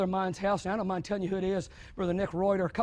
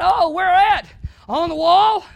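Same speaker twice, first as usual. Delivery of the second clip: alarmed dad; 9 semitones higher, 12 dB louder, slower than usual. Retold or imitated. Dad's alarmed reply.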